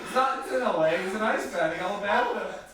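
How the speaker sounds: high, loud cheering on; excited coaching. Real, not acted. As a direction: hype up a friend in an ice bath. Coaching friend through ice bath.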